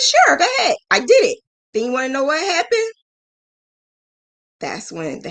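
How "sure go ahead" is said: much higher, breezy go-ahead; lightly imitated ladies granting permission; cheery permission. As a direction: toss off an easy yes in their voice. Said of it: "They said".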